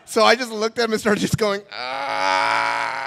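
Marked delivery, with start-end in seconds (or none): 1.7-3.1 long high drawn-out "ah"; helpless mock wail. Teen stuck for an answer, helpless mock wail. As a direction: hold a high, dumbfounded cry. Teen's speechless acted wail.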